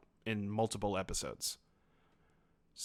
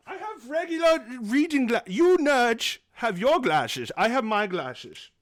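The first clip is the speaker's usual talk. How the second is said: silly high voice; 11 semitones higher, 5 dB louder than usual. Acted. Hammy character in sunglasses.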